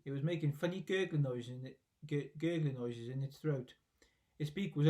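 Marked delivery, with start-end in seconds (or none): none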